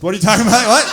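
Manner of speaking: very loud mock-incredulous shout; baffled outburst. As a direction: explode in comic disbelief. Acted baffled outburst at the complaint.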